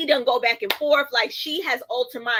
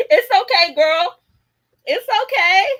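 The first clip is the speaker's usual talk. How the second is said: much higher and louder, comforting squeal; reassuring; 8 semitones higher, 9 dB louder than usual. Real, not acted. Streamer comforting a viewer who says she's too light, bubbly.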